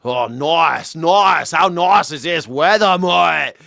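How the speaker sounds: hammed-up, drawn-out Aussie accent; thick Australian accent. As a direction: turn the Australian accent way up for passengers. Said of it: "he turns it way up".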